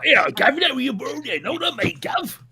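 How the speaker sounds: high-pitched Cockney caricature; hammed-up Cockney mate banter; mock Cockney. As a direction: imitate incomprehensible Cockney chatter. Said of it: "what people from Cockney are saying".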